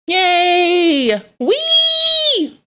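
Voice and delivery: high drawn-out squeals of glee; playful high-pitched whoop; mock cheering. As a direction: squeal it like a delighted kid. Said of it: "I'll be like".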